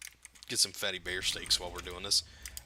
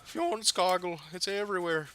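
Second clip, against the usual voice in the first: high delighted squeal; high excited; 9 semitones higher than usual. Real, not acted. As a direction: gush at the treasure. Delighted at loot.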